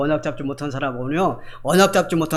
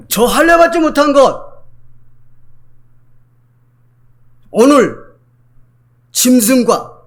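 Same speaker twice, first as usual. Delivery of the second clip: high and loud defiance; defiant cry; 6 semitones higher, 6 dB louder, slower than usual. Acted. David to the king about Goliath, loud high defiance. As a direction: fling the challenge at the giant.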